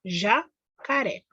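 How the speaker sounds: "Jacaré" said slowly, syllables drawn out; slow clear word. Shown as a demonstration. Teacher reading the finished word "Jacaré" to children. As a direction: stretch out "Jacaré" for the class. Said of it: "vocês vão escrever o já aqui".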